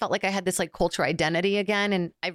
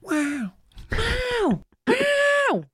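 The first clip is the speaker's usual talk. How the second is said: high swooping catchphrase, climbing higher each time; Owen Wilson; much slower than usual. Acted. Guest showing off an actor impression, high and swooping. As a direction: mimic a celebrity's wow, higher on every repeat. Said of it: "I can't think of Owen".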